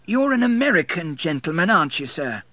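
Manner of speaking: slightly mocking old warden; church warden. A narrator's reading.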